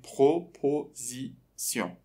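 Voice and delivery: "پروپوزیسیون" in slow separated beats; syllable by syllable. Shown as a demonstration. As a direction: chop "پروپوزیسیون" into syllables with clear pauses. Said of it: "بخش به بخش".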